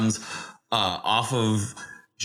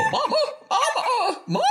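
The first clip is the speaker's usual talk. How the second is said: very high little spider calling; tiny creature voice. Acted. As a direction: cry out high like a small child. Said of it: "you can hear him go".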